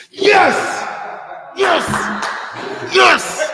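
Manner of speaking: loud, high cheer of joy; excited cheering. Real, not acted. Host cheering a boy's answer, loud and high. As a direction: cheer him on at the top of your voice.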